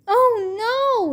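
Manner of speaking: high-pitched drawn-out alarm; shriek. A narrator's reading. Babysitter hearing the girls scream, shriek. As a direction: shriek it in dismay. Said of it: "Nancy shrieked".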